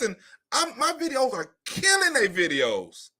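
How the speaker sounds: usual speaking voice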